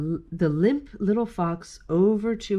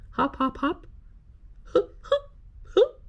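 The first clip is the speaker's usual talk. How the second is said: short, high hiccupping sobs; storybook fox sobbing in little bursts; pretend sobbing; 9 semitones higher than usual. A narrator's reading. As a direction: act out catchy, gulping sobs. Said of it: "sobbed the fox".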